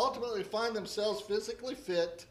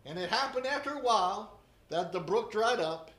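scripture reading; 3 semitones higher than usual. Acted. Scripture reading, divine command.

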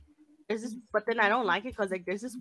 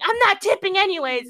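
spiteful retort; 10 semitones higher, 8 dB louder than usual. Retold or imitated. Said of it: "He's like".

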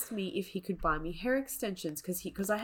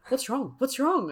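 raised, anxious repeated question; mock panicked; 7 semitones higher, 4 dB louder than usual. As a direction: imitate him panicking, pitched up. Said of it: "And he's freaking out".

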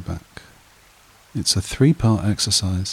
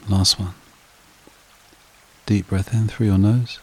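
guided meditation; 4 dB quieter than usual. Acted. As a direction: lull them evenly, softening as each phrase settles. Leads a breathing meditation.

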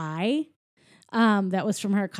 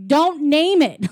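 louder, higher, drawn-out retold reproach; mock scolding; 6 semitones higher, 6 dB louder, slower than usual. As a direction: lean slowly and loudly on each word, imitating exasperation. Acting Mike's scolding.

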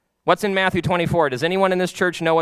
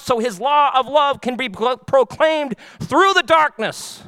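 preaching that climbs higher and louder; building sermon; 5 semitones higher, 4 dB louder than usual. Acted. Pastor urging the congregation toward the Holy Spirit, preaching higher and louder.

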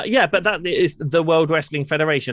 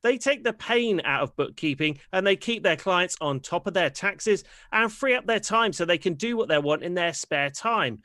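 ad read; 4 semitones higher, 3 dB quieter than usual. Acted. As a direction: read it like a commercial. Sponsor read.

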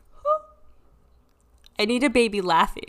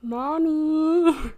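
put-on eerie child voice; 6 semitones higher, slower than usual. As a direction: call out like an eerie little boy. Dead child calling.

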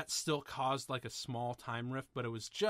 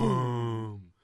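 imitated sonic boom; 2 semitones lower, 9 dB louder than usual. Host imitating the time barrier breaking, loud boom. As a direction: imitate a blast breaking the silence. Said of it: "Everything goes quiet, and then".

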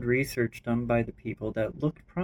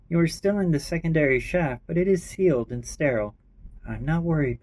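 lightly raised character voice; 5 semitones higher, 4 dB louder than usual. Acted. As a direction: voice the characters a bit higher.

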